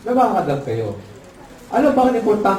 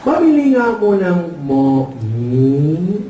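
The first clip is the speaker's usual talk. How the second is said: slow, drawn-out ngongo voice; nasal-speech customer; 3 semitones lower, much slower than usual. Acted. Ngongo customer ordering at the store, muffled nasal speech. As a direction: imitate muffled cleft-palate speech, slowly. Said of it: "ngongo din".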